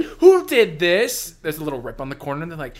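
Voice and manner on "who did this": outraged yell. Pretend. Acted office worker's outrage.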